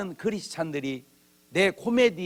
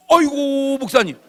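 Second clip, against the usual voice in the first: higher, louder gushing call; fawning greeting; 7 semitones higher, 7 dB louder than usual. Retold or imitated. Smoker hiding his cigarette greets the pastor, gushing. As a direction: greet him like you got caught.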